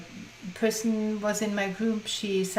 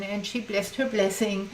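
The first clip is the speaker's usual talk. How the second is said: ordinary talk, nothing marked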